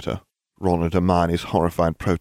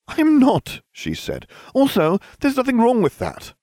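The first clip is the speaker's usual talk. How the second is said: put-on high, loud indignant girl; young witch insisting; Hermione's voice; 4 dB louder than usual. A narrator's reading. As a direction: protest hotly. Hermione protests.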